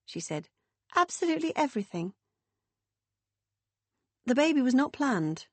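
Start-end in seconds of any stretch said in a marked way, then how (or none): 0.9-2.1 high, quick, mock-firm insistence; playful insistence. A narrator's reading. Meg teasing Bill from bed, quick and high. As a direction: lean on absolutely with a grin.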